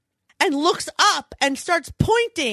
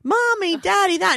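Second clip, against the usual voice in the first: put-on crying toddler; 6 semitones higher than usual. Mother imitating her toddler calling from his room, wailing. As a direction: imitate a little kid calling out in tears. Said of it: "he's crying and screaming".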